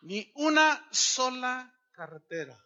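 "Ni una sola" hit high and loud, falling on "cartera"; emphatic repeat. Real, not acted.

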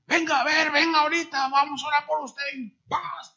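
exaggerated preacher's summons; mock showy preacher. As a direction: play the pushy healer summoning someone up. Mocking preachers calling people forward.

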